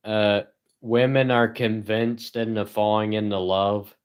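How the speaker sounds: ordinary delivery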